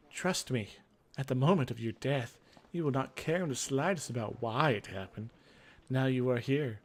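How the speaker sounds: usual speaking voice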